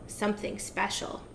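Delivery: breathy hushed whisper of longing. A narrator's reading. Narrator whispering the witch's craving to listeners. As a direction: lean in and whisper it like a secret.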